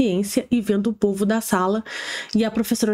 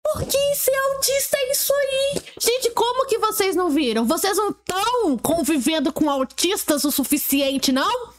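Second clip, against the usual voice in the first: much higher, sing-song mocking voice; sarcastic mockery; 10 semitones higher than usual. Mocking the mother's claim to the audience, high sarcastic voice. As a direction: ridicule the claim in a falsetto.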